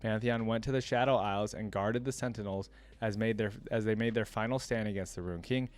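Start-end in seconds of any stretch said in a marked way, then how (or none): none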